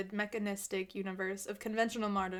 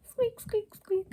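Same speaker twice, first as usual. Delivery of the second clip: squeaky sounds, much higher; mock squeaks; 12 semitones higher, 5 dB louder, slower than usual. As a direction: squeak it out like a tiny toy.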